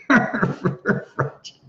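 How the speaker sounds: breathy laugh through the word French. Real, not acted. Laughing at own French joke.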